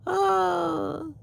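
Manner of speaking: high shocked cry; a sharp high oh. Real, not acted. Shocked by the weather.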